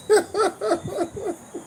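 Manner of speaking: high-pitched giggling laugh. Real, not acted. Streamer cracks up at her own helium joke, high laugh. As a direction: burst into a high, rapid laugh.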